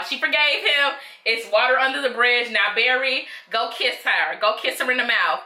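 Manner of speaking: ordinary talk, nothing marked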